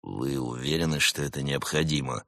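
grudging doubt from a dignified agent; displeased. A narrator's reading. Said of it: "недовольно спросил Барс".

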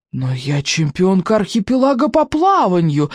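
ordinary delivery

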